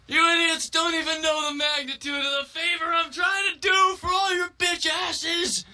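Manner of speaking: very high, hysterical acted shouting; struggling shout. Boy held on the ground by students, shouting. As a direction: shout it while fighting to get free. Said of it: "while he struggles and shouting".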